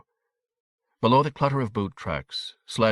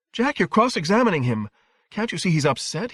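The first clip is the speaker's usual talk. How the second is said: upset protest; 10 semitones higher than usual. A narrator's reading. Wife telling her husband to stop cross-examining their son, agitated. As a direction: leap up in pitch to object. Mother protests the father's questioning.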